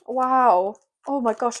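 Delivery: normal talking voice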